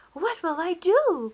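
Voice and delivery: high, loud and slow, Walter panicking; distressed cry. A narrator's reading. Baker in a panic after the cat spills the milk, crying out. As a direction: wail it out in alarm. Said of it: "cried Walter".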